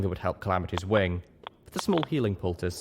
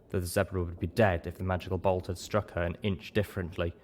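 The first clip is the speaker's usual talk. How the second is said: usual speaking voice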